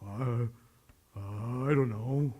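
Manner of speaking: low, soft, slow hesitant mumble; mock unsure mumble. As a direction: play a student who has no idea, mumbling. Mock unsure student answering.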